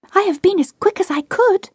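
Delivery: put-on high, hurried little-girl voice; high-pitched, as if just back from running; panting girl. A narrator's reading. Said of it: "she panted".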